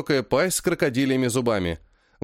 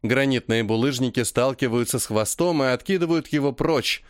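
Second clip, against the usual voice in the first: plain reading voice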